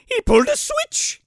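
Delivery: very high mock-incredulous voice; mocking squeal. Boss throws the henchman's excuse back, mocking high voice.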